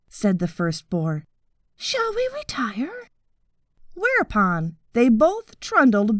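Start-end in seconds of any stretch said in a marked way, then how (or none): 1.8-3.1 breathy whispered suggestion to leave. A narrator's reading. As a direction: whisper it, ready to slip away. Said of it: "talking in low, solemn whispers".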